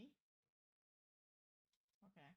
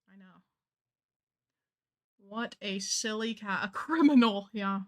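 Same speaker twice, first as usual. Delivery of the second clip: ordinary delivery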